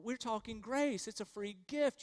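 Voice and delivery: ordinary talk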